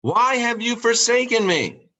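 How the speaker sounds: high, pleading cry to heaven; anguished cry. Retold or imitated. Jesus crying out to God, high and pleading. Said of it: "he would cry out".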